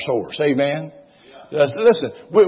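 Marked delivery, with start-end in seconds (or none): 1.8-2.5 high, loud, fervent preaching; preaching at full force. Real, not acted. Pastor urging the congregation to act in faith, fervent. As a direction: build to a shout of faith.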